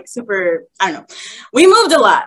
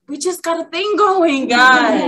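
high-pitched hammed-up wail; struggling protest; 7 semitones higher, 3 dB louder than usual. Disciple begging God not to take her girls, high mock wail. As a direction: protest like a distraught child, stretching words. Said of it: "I was struggling".

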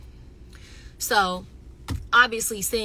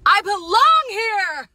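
loud, high, drawn-out mock yell; hammed-up proclamation; 12 semitones higher, 12 dB louder, slower than usual. Retelling seeing herself on camera, hammed-up proclamation. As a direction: belt it out slowly, pitched way up. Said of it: "and I was like".

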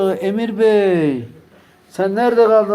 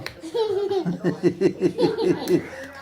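high laugh; 11 semitones higher, 7 dB quieter than usual. Real, not acted. Adult laughs while head is shaved.

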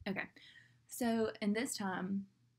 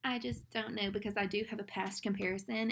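ordinary talk, nothing marked

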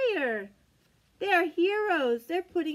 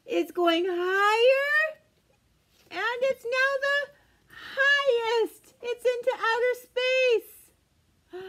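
slow, higher and louder build; rising excitement; 7 semitones higher, 4 dB louder, slower than usual. Acted. Reading to children, excitement rising with the rocket. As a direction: launch it like the rocket.

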